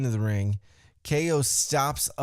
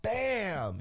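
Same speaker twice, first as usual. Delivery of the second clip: high, falling sound-effect "bam"; impact exclamation; 8 semitones higher, 4 dB quieter than usual. Retold or imitated. Fan recapping a surprise kick, exclaimed impact. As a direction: interrupt yourself with a raised hit noise.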